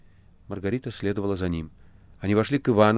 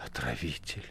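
gloomy hatred; 6 semitones lower, 13 dB quieter than usual. A narrator's reading. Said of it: "мрачно и с ненавистью".

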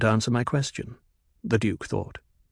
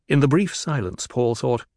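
ordinary delivery

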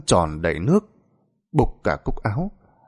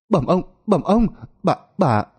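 high, frantic shriek of alarm; panicked shout; 9 semitones higher than usual. A narrator's reading. Servant boy bursting in to warn the master, panicked shout. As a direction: servant boy crying out in terror. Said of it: "la lên thất thanh".

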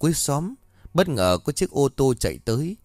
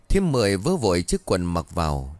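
ordinary delivery, nothing marked

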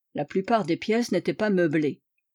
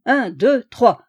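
louder count building to three; 3 semitones higher, 7 dB louder, slower than usual. Acted. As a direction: count them in. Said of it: "Alors, allons-y".

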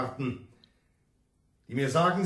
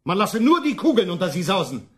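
threatening; 5 semitones higher, 6 dB louder than usual. Acted. Envoy threatens peasants with bullets.

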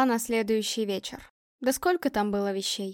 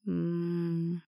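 soft, low "mmm" of pleasure; blissful exhaled hum; 4 semitones lower, 10 dB quieter than usual. A narrator's reading. Man enjoys touching the girl, blissful low hum. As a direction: hum it out on a sigh. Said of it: "выдохнул он".

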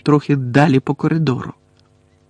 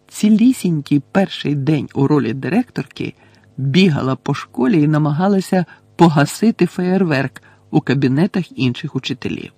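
ordinary delivery

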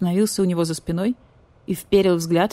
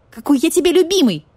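higher, louder furious outburst; enraged; 10 semitones higher, 6 dB louder than usual. Acted. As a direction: explode at the traitor. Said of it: "взбесился барсукот".

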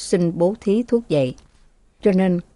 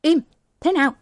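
shout; 9 semitones higher, faster than usual. Acted. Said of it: "Thừa cố thét ra tiếng".

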